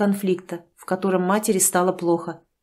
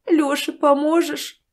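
squeezed out slowly, higher, in tears; through tears; 7 semitones higher, slower than usual. A narrator's reading. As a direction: force the plea out while crying. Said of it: "сквозь слёзы выдавила женщина".

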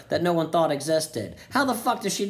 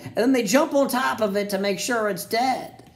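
ordinary talk, nothing marked

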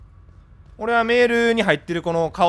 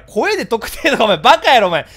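loud scolding, voice pitched up; exasperated shout; 3 semitones higher, 7 dB louder than usual. Real, not acted. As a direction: yell at them for being silly. Scolds caller over voice fear. (声で特定を怖がる相手を大声で叱る)

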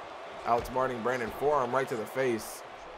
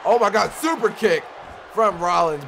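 loud, higher hyped call; excited shout; 7 semitones higher, 8 dB louder than usual. Real, not acted. Commentator calling Rollins' super kick, loud excited shout. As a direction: yell it out as the kick lands.

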